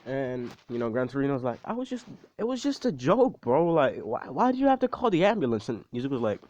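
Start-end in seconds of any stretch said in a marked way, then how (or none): 1.6-5.7 whiny protest. Retold or imitated. Gran Torino protesting the prank.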